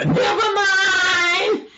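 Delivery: high, loud, drawn-out holler; exasperated yell. Acted. Animal gives up asking for food, long exasperated yell.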